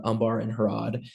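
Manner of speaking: ordinary delivery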